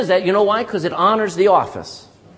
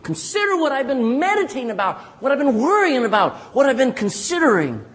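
begging; 7 semitones higher than usual. Retold or imitated. Preacher quoting David begging God, pleading insistently. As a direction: beg to be heard, pressing each phrase. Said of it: "begs God".

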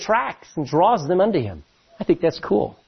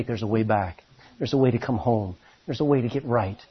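softer, lower, tender promise; gentle reassurance; 8 semitones lower, 5 dB quieter than usual. Acted. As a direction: reassure a lost soul softly. Holy Spirit's gentle call.

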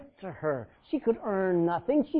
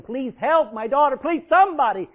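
a desperate mother begging the disciples; 8 semitones higher, 13 dB louder than usual. Acted. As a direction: cry out, begging for help. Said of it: "She begged them".

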